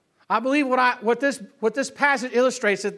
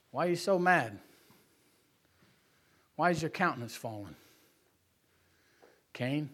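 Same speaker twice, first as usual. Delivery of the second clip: low, much softer, solemn question; hushed Lord; 12 semitones lower, 12 dB quieter than usual. Acted. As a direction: speak gently as God to Cain. The Lord speaks to Cain.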